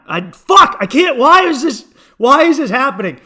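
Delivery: loud, high outburst of frustration; exasperated. Real, not acted. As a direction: cry out, fed up with your own failure. Frustrated at failing his own accent.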